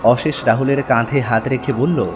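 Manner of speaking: usual speaking voice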